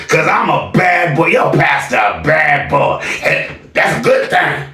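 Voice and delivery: imitating the pastor. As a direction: ham up a bragging pastor. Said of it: "your pastor got up there and said something like this".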